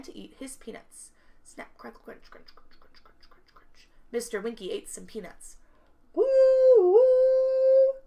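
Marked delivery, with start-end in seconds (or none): 1.4-3.9 whispered, fading crunch sounds; peanut-crunching noises. Acted. Imitates eating peanuts. 6.1-7.9 loud, high sustained hoot; train whistle. Acted. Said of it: "the train whistled".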